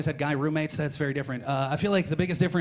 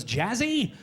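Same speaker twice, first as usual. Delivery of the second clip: mishearing rise; 4 semitones higher than usual. Retold or imitated. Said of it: "He goes".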